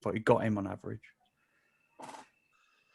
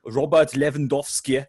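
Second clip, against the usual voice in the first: put-on pundit overdoing the foreign accent on Robert Lewandowski; exaggerated native-style accent on the name; pronounced foreign accent; 4 semitones higher, 4 dB louder than usual. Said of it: "they say the names in such a pronounced accent".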